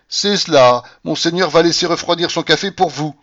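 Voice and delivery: provocative. A narrator's reading. Abbé taunts the waiting Russian, provocatively. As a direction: needle him with mock agreement. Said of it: "me dit d'un ton provoquant".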